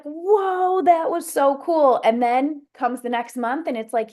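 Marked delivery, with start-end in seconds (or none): 0.0-2.0 high, bright-eyed whoa at the fireworks; wowed. Pretend. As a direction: gasp it out like a kid watching fireworks.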